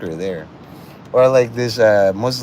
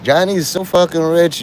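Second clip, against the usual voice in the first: excited; 7 semitones higher, 4 dB louder than usual. Real, not acted. Excited rant opener.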